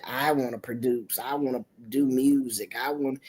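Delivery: low, soft, offhand son; a mild hint of a mumbling teenager; lightly voiced son. Son telling his mother his plan, low and soft.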